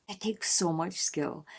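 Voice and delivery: awed whisper. Real, not acted. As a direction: breathe it in awe. Awed aside then repeated.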